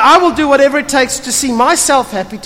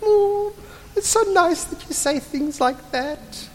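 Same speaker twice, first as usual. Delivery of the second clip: higher, softer, cooing flattered wife; mock swooning wife; 8 semitones higher, 8 dB quieter than usual. Sarai answering Abraham's compliments, mock cooing. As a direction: coo like a flattered bride. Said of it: "She's like".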